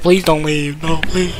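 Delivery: drawn-out, whiny imitation of pleading; exaggerated mock plea, slowing to a wail; mock begging. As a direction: mimic someone begging, stretching the words out. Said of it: "Begging on your knees".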